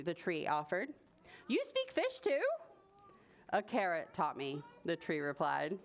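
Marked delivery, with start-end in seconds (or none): 1.5-2.4 high-pitched amazed question; surprised boy. Acted. Boy amazed the tree speaks fish, high question. 3.5-4.6 low, matter-of-fact reply; low voice, straight-faced; deadpan tree. Acted. Tree telling the boy a tall tale, deadpan.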